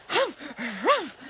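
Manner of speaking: loud, high spluttered grunts; huffing cartoon grunts; muffled monster noises. A narrator's reading. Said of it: "mumbled the monster".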